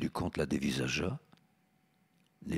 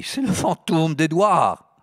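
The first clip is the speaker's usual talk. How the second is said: mad voice; 10 semitones higher, 9 dB louder, slower than usual. Acted. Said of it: "murmura d'une voix de folle".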